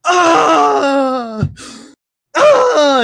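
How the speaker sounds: very high, loud comic scream; hammed-up Ace Ventura yells; mock scream. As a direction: shriek like a cartoon in pain. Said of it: "Ace Ventura".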